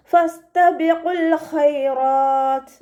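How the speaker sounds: slow, drawn-out melodic tajweed chant; Quran recitation. Shown as a demonstration. Teacher models melodic Quran recitation for students. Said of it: "ഫ light ആക്കിയിട്ട് ഉച്ചരിക്കാൻ".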